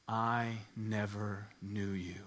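low, slow, hushed pronouncement; grave divine rejection. Acted. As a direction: pronounce judgment slowly, dropping soft. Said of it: "those horrible, terrifying words".

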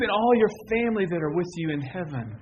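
ordinary talk, nothing marked

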